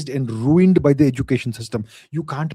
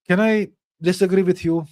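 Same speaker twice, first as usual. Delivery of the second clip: ordinary talk, nothing marked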